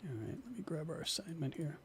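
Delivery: whispered aside to yourself. Real, not acted. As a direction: breathe it under your breath. Reading notes aside to self.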